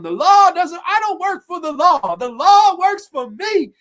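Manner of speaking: high, loud policewoman rant; hammed-up shrieky rant, slowing at the end; mock tirade. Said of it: "she's going off on".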